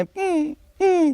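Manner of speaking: high whimpering dog hum; imitated dog whimpering, high and thin. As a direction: whine like a beaten dog. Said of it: "I do a good ਕੁੱਤੇ ਵਾਲੀ impression".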